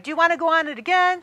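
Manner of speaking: high, fast eager question. Retold or imitated. Friend eagerly asking to ride again. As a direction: rush to ask again. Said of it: "And then you decide".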